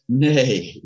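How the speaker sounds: a horsey neigh for no; playful horse neigh saying nay. Pretend. Director answers like a horse.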